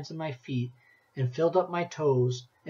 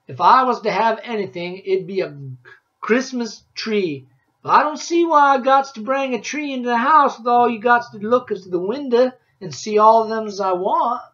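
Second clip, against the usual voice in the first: put-on old woodsman Rancid; 6 semitones higher, 10 dB louder than usual. A narrator's reading. Woodsman tells the boy about Christmas trees, loud backwoods voice. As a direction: boom it out like a grizzled mountain man.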